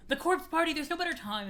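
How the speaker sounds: strained, failing voice; voice giving out. Real, not acted. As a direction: push through a voice that keeps dying. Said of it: "Why is my voice dying?".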